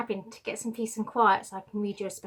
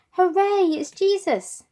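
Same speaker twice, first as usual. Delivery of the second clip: high, louder crowd cheer; shouted cheer; 9 semitones higher, 9 dB louder, slower than usual. Acted. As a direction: cheer him into the city. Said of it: "they shouted".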